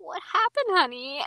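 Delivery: high-pitched mock motherly concern; concerned parent. Comforting someone on a live stream, imitated worried parent. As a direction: coo it like a fussing parent. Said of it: "we literally sound like their parents".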